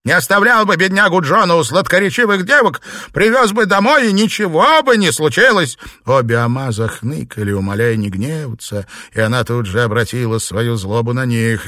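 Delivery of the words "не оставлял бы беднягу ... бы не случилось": loud, high accusing tirade; angry blame. A narrator's reading. Wife blaming the driver, angry shout. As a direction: lash out at him.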